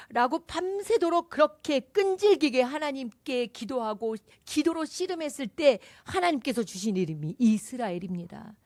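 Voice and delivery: normal talking voice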